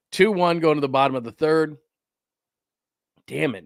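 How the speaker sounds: ordinary talk, nothing marked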